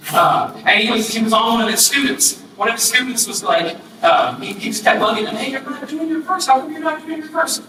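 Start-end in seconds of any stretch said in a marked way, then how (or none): 5.3-7.6 put-on higher, needling kid voice; a teen badgering an elderly mentor, higher; nagging student. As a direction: pester him about his verse. Student nagging the old man.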